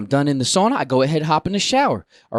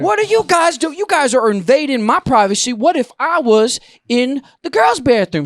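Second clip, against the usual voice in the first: loud, high-pitched outrage; retold worked-up complaint; indignant scolding; 10 semitones higher, 5 dB louder than usual. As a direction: protest the invasion heatedly. Retold argument with intruders.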